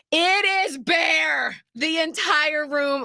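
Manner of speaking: high, drawn-out exclamation; slow, high and aghast, each word landed; emphatic shock. Real, not acted. Daughter reveals mom's emptied room to friends, emphatic shock.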